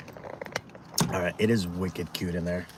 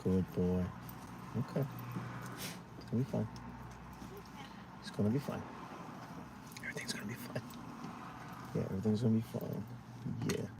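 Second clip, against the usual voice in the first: soothing; 14 dB quieter, faster than usual. Real, not acted. Soothing the anxious dog.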